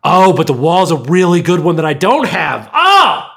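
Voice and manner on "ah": excited shout. Real, not acted. Excited about a favourite song.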